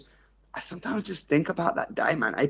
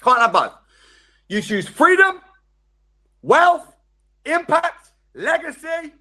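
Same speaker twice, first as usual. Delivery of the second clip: high, loud, punchy list; emphatic shout; 11 semitones higher, 9 dB louder, slower than usual. Real, not acted. To the audience, shouting the choices out. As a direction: hit each word like a drumbeat. Hammering home freedom over comfort.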